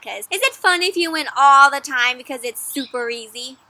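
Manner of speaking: ordinary talk, nothing marked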